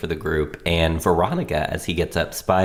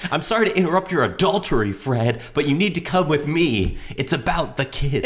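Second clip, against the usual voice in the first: [name]; 6 semitones higher than usual. Retold or imitated. Alice quote, best line.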